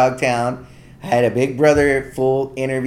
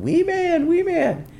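imitated yelling; slower than usual. Japanese fans spotting him from their stores, imitated high yell. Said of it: "coming out of stores yelling".

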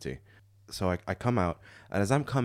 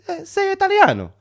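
very high, loud mock Italian shout; Italian yell; 7 dB louder than usual. Stranger yelling from his car, high Italian-accented shout. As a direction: repeat the call in broad Italian. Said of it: "he starts yelling out of the car".

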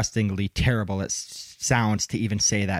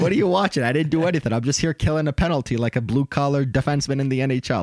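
indignant hockey player protesting to the ref, a bit higher; mock innocent player; 5 semitones higher than usual. Player protesting his penalty to the ref, mock outrage. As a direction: play the wronged defenseman pleading innocence. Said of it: "be like".

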